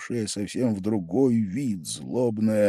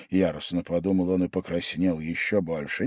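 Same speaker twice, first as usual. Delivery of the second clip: ordinary delivery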